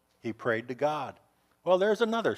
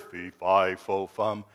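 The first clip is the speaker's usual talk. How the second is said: giant's fee fi fo fum; 9 semitones lower, slower than usual. Retold or imitated. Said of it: "And he heard".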